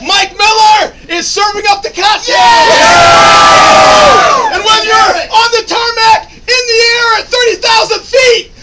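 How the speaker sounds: loud rallying crowd hype; halftime pep speech. Real, not acted. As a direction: fire up the bar crowd. Rallying crowd at Bills bar.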